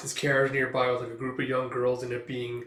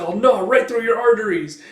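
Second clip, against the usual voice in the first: high, loud snarling threat; defiant threat; 8 semitones higher, 11 dB louder than usual. Acted. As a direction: spit the threat up at him. Elf threatens thug.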